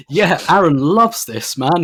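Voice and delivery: loud, slow sarcastic mockery. Sarcastic mock of critics.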